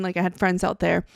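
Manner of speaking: ordinary talk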